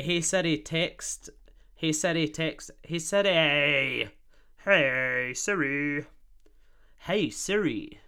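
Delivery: loud, drawn-out command to a phone; calling Siri. Real, not acted. Host summoning the phone assistant, drawn-out call. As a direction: summon the assistant, stretching the words.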